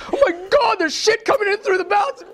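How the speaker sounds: shrieking high mock panic; panicked tenants. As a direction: squeal it like freaked-out students. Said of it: "the tenants are all like".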